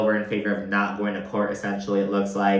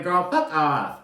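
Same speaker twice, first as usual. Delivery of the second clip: sassy dismissal; 5 semitones higher, slower than usual. Retold or imitated. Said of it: "It's like".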